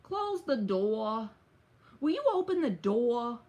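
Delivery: drawn-out mock New York accent. Asking a viewer if New Yorkers talk like this, in a slow put-on New York accent. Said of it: "Is that how New York people talk".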